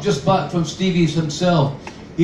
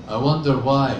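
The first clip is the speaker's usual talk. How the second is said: ordinary delivery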